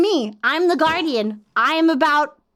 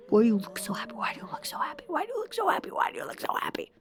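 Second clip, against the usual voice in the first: soft repeated whisper; 5 semitones higher, 10 dB quieter, much faster than usual. Shown as a demonstration. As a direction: hiss it under your breath.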